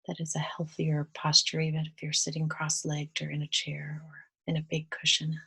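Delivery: guided meditation. Real, not acted.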